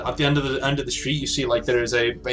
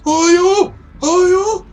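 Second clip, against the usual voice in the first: very high, loud, panicked screech; hysterical shrieking; 9 dB louder than usual. Acted. Elderly woman in bed to intruders, hysterical shrieking. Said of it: "getting hysterical".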